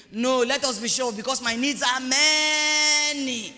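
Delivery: hammed-up plea, dragging out "many"; mock greedy petitioner. Church member tells God of many needs, hammed-up.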